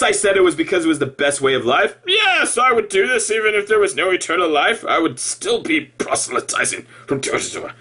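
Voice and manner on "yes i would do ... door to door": high, mock-earnest recital; company line. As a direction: parrot the official answer in a put-on voice. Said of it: "that's the company line".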